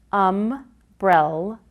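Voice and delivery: "umbrella" slow, as um-brel-la; syllables one by one; segmented slowly. Shown as a demonstration. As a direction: split "umbrella" into slow beats. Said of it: "is made up of".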